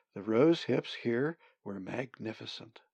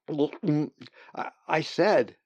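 repeating louder; 5 semitones higher, 5 dB louder, much slower than usual. Acted. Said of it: "Brendan repeated".

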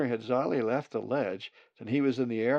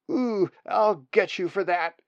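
high, loud playful chick threat; a young raven teasing back, pitched up; mock threat; 9 semitones higher, 9 dB louder than usual. Nestling sibling squabble.

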